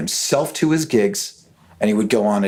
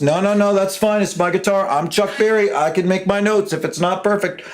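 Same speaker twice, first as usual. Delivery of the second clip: much higher, brash and swaggering star voice; 9 semitones higher than usual. Retold or imitated. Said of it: "that's exactly how he said it".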